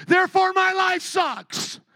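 mock whiny shout. Mock complainer's conclusion.

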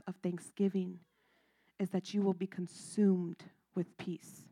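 soft, intimate sermon voice; hushed preaching. Acted. Pastor drawing the congregation close: soft, hushed delivery. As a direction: draw them in quietly.

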